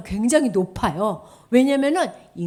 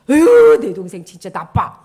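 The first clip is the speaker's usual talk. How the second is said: exasperated complaint; 12 dB louder than usual. Acted. Child complaining about sibling.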